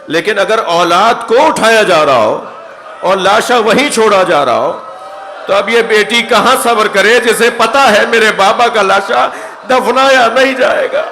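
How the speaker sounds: builds from firm preaching to a high, loud anguished cry; rising lament. Acted. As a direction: start strong and climb until the last words break into a shout. Preacher building to grief-stricken cry. (بلند ہوتی ہوئی پُرسوز صدا جو آخر میں چیخ بن جائے)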